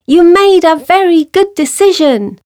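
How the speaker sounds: high mouse voice calling back; shouted. A narrator's reading. Escaped mouse to lion, high shouted call. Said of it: "she shouted".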